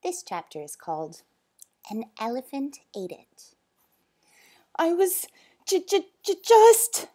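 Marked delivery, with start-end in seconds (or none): 4.8-7.1 high, loud stammer; spluttering. Acted. Boy caught by the zoo owner, spluttering. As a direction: stutter on the first sound, climbing higher. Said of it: "spluttered Bob".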